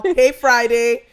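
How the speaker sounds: ordinary talk, nothing marked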